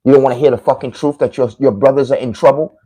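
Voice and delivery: ordinary delivery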